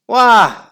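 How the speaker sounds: put-on high, loud babyish wah; mock wail. Weepy William wailing in class, hammed-up wah. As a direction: wail like a whiny kid. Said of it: "wailed Weepy William".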